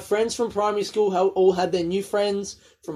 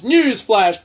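news announcer; 4 semitones higher, 7 dB louder than usual. Pretend.

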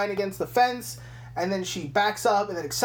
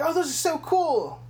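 much higher starstruck cop; gushing cop; 9 semitones higher than usual. Retold or imitated. The cop admiring the doll, much higher and gushing. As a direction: gush like a fanboy.

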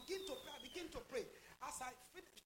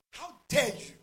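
sudden louder burst out of hushed storytelling; imitated furious rebuke; 16 dB louder than usual. Furious attacker confronting the preacher in her retold vision, imitated angry rebuke. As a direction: act out an angry challenge, briefly. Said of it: "he was furious and angry".

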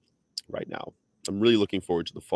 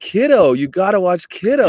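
loud, high-pitched eager recommendation; coşkulu alıntı; 11 semitones higher, 10 dB louder than usual. Retold or imitated. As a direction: blurt it out louder and higher, full of enthusiasm. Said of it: "And I said".

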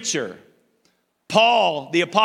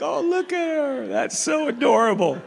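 cooing adult; 7 semitones higher than usual. Retold or imitated. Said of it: "It's like".

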